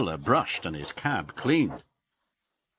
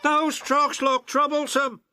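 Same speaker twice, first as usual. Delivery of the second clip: laughing James; 5 dB louder than usual. Acted. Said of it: "laughed James".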